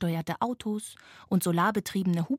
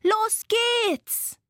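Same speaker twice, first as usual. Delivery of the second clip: very high, excited battle cry; called out; 5 dB louder than usual. A narrator's reading. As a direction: launch the attack with a cry. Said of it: "rief Laila". (begeisterter, hoher Ausruf)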